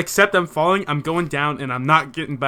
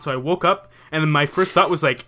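normal talking voice

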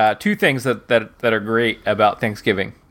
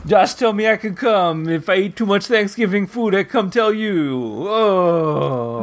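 put-on light Italian accent, overfed; 8 semitones higher, slower than usual. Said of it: "very Italian accent".

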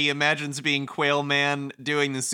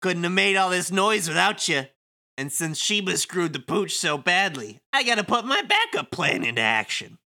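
breezy, mocking wiseguy; sly villain Kubo; 5 semitones higher than usual. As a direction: thank them with smug false gratitude. Plays Kubo reading game script.

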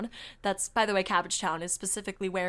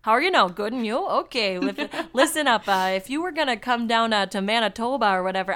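put-on loud, jokey Canadian accent; 7 dB louder than usual. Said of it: "do a Canadian accent for this".

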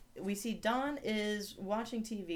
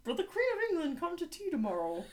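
high, hammed-up British Muppet voice; comic British accent; 10 semitones higher than usual. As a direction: overdo a silly British accent. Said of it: "do a British accent on the pod".

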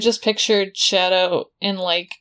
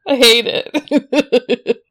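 laughing; 4 semitones higher, 4 dB louder, much slower than usual. Real, not acted. Laughing at the comparison.

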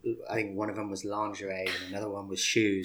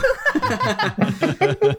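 loud laughter; 12 dB louder than usual. Real, not acted. Helpless laughter at story.

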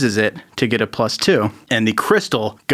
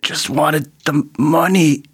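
dying confession; 3 semitones higher, slower than usual. Acted. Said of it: "he's like".

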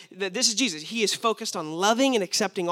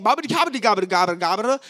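fast, louder mock gibberish rattled off; fake Spanish gibberish; 5 dB louder, much faster than usual. Preacher pretending to speak Spanish, fast mock gibberish. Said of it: "I'm speaking Spanish".

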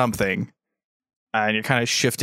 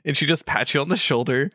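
ordinary talk

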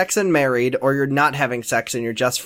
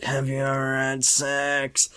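soft, slow imitation; drawn-out, hushed mock creep; creepy question; 3 semitones lower, 8 dB quieter, slower than usual. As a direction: ask it slowly under your breath. Imitating Grant's sleazy question.

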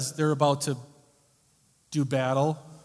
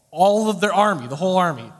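emphatic; 3 semitones higher, 7 dB louder than usual. Shown as a demonstration. Stressing the whole army.

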